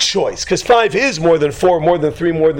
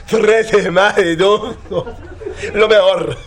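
higher, slower comic Spanish reply; mock Spanish outburst; 6 semitones higher, slower than usual. Storyteller acting out a trader's reaction, mock outburst. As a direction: exclaim it in hammed-up Spanish, near laughter.

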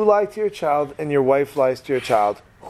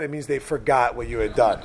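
usual speaking voice

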